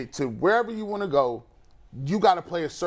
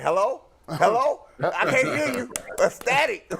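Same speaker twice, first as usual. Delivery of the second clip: high, laughing fake bad phone line; bad connection; 8 semitones higher than usual. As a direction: pretend the call keeps cutting out. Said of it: "they were like".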